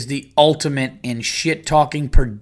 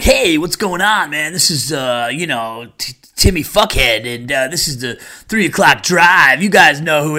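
cheesy radio DJ; 2 semitones higher, 5 dB louder than usual. Retold or imitated. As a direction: ham up the three o'clock drive host. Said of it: "if those guys really sounded like that".

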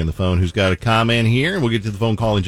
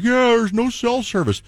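mock liar voice. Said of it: "So I was like".

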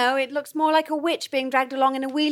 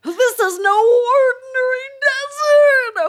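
loud, slow theatrical doom; ominous warning; 10 semitones higher, 8 dB louder, slower than usual. Acted. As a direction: boom it like a prophecy. Dramatic ominous declaration.

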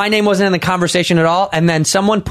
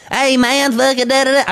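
high, mocking impression of Theo; high, mocking take on Theo; imitating Theo; 7 semitones higher than usual. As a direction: jump up high to mimic Theo's excited pointing. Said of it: "I've heard Theo".

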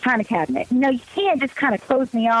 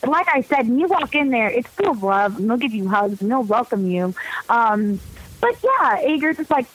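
usual speaking voice

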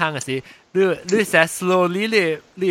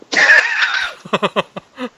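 high burst of laughter; real laughter; 4 dB louder than usual. Real, not acted. Telling of asking for help, real laughter.

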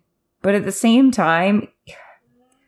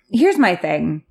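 ordinary delivery

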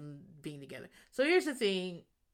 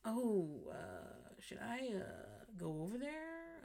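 half-hearted hesitant murmur; 3 semitones higher, 11 dB quieter, slower than usual. Retold or imitated. Brian hesitant, half-hearted offer.

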